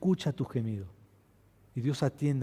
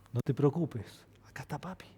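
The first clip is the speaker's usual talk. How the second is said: soft, tender reassurance; comforting father; 6 dB quieter than usual. Retold or imitated. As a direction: hush the child gently. (padre consolando con ternura a su hija)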